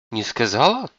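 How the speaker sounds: puzzled astonishment, rising at the end; surprised question. A narrator's reading. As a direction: echo it back in surprise. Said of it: "удивилась Сунок".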